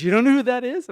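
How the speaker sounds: high, loud mock-astonished question; retold incredulity. [name] amazed at friend not knowing, incredulous. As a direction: act out a friend's loud disbelief.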